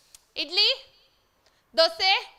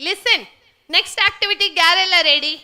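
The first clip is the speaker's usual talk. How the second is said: 大声喊全场; 像主持人那样喊大家集合; calling out; 3 semitones higher, 8 dB louder, faster than usual. Real, not acted. Calling out to the group.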